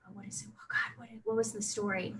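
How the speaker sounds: whispered aside while trying to remember; muttering to oneself. Real, not acted. Storyteller asking herself what the story was, whispered. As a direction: think aloud under your breath, very softly.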